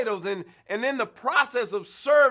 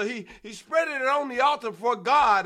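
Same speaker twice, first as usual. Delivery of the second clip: ordinary talk, nothing marked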